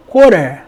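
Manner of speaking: falling American "quarter"; American pronunciation. Shown as a demonstration. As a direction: say "quarter" with a flapped T and final R. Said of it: "미국식".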